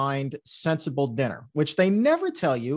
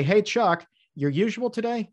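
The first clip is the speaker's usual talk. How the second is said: cheery drive-thru worker; 7 semitones higher than usual. Retold or imitated. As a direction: greet a familiar customer cheerfully. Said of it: "they would say".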